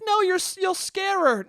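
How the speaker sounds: high put-on mom voice; mom objecting. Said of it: "She's like".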